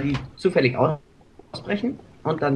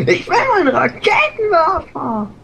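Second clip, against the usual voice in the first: mocking whine; 14 semitones higher, 3 dB louder than usual. Mocking a teammate's complaint.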